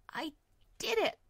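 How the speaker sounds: high, loud cry of disbelief; dazed cry. Acted.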